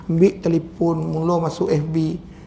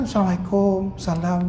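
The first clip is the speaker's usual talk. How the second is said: gentle greeting. Shown as a demonstration. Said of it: "lemah lembut". (salam lemah lembut)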